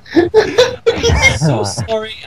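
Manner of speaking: breathless, slow, laughing whisper; whispered through laughter. Real, not acted. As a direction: apologize while still cracking up. Laughing apology after the joke.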